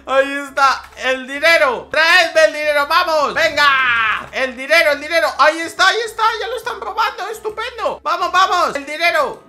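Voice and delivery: high, loud thrilled shouting; excited yelling. Real, not acted. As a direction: yell in greedy delight. Excited crime boss yelling.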